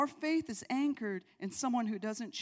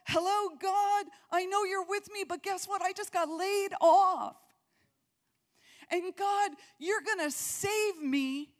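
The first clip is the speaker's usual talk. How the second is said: raised, pleading voice to God, half-joking; mock worried prayer; 8 semitones higher, 5 dB louder than usual. Preacher voicing a doubter praying to God, pleading.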